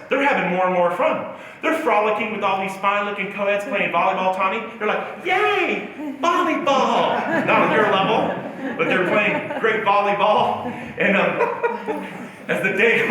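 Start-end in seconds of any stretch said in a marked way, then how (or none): 5.2-7.5 high, drawn-out silly cheer; mock glee. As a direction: imitate giddy friends cheering, stretching it out slowly. Mimics friends frolicking.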